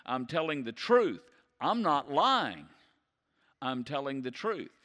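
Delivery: loud falling stress on "truth" and "lying"; Paul pleading to be believed; emphatic pleading. Retold or imitated. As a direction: insist you are not lying. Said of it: "Paul saying".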